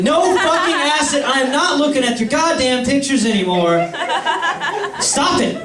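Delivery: high, fed-up outburst; indignant, rising protest; exasperated refusal. Acted. Acted argument with Acid.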